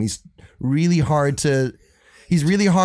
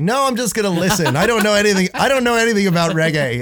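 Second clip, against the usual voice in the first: high, louder mock protest; mock-flustered excuse, pitched up; retold panicky refusal; 9 semitones higher, 4 dB louder than usual. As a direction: beg off in a raised, hammed-up voice. Retells refusing to get up and play.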